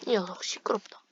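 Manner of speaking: sly teasing whisper; mock whisper. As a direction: whisper it to needle him. (놀리는 속삭임)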